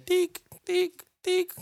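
high beeping checkout scanner sound. Retold or imitated. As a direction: beep like a till scanner. Imitating scanner beep.

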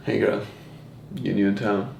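low, soft smooth pickup voice; serious pickup line. Shown as a demonstration. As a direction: play it serious and suave. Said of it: "in a serious way".

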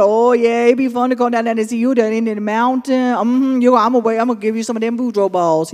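a lively Cajun granny tease; old Cajun woman. Retold or imitated. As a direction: Cajun accent, playful and bright. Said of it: "she's an old Cajun woman".

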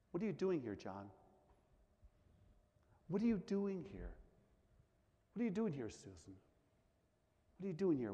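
hushed, gentle questioning; the quiet voice of God asking each listener; still small voice. Acted. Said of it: "that still small voice say the same thing".

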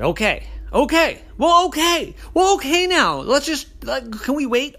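very high, loud fluster; high squeaky fluster; flustered sister. A narrator's reading. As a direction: babble it in a panic. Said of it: "Sister said".

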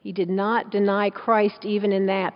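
usual speaking voice

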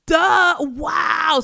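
self-mocking eureka shout; sudden realization. Real, not acted. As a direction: smack your forehead with it. Realizing the obvious pun.

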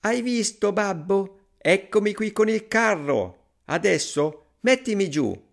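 put-on high, proud little-boy call; Pollicino, calling out. A narrator's reading. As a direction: show off to dad in a high child voice. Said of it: "gridò". (vocina acuta e trionfante)

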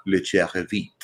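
"Le Charrevit" pronunciado a la francesa; acento francés en broma. Pretend. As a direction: nombre mexicano afrancesado con acento francés. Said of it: "si les gusta el francés". (acento francés fingido)

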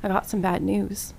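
low, soft mock-solemn bad news; mock grave. Retelling the brother's call, mock solemn.

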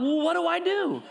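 a lightly voiced landowner asking what now; puzzled landowner. Landowner asking the crowd for advice, mildly puzzled. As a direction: shrug it out as the stumped owner. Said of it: "the landowner's looking at him like".

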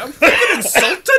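high, loud, slow mock indignation; Billy Connolly. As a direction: play an offended Scottish comedian through laughter. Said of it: "Mister Connolly's listening like".

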